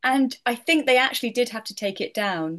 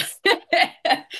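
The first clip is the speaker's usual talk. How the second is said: laugh; 11 semitones higher than usual. Real, not acted. Laughing after her remark.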